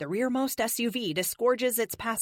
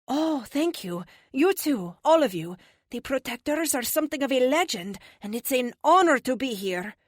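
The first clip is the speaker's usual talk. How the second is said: put-on higher-pitched, formal accented greeting; polite foreign visitor; 6 semitones higher, 5 dB louder than usual. Police officer meeting the team, polite accented voice. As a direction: greet them courteously in a raised, accented voice. Tia thanks team in figure voice.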